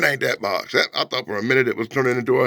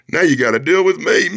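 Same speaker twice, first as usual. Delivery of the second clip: higher threat rolling into a laugh; threaten, then cackle theatrically; hammed-up evil laughter; mock villain; 5 semitones higher than usual.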